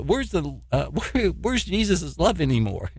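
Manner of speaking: normal talking voice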